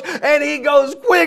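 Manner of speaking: ordinary delivery, nothing marked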